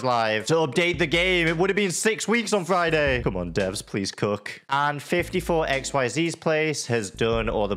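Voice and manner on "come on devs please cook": ordinary delivery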